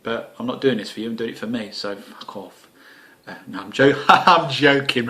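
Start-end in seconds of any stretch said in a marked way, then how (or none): 3.7-5.1 laughing. Real, not acted. Laughing off a rude joke.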